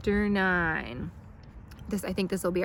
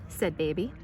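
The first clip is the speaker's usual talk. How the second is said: ordinary delivery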